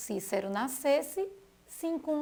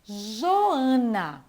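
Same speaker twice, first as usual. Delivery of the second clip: normal talking voice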